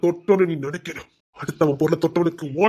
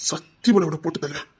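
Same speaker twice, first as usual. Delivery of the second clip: ordinary delivery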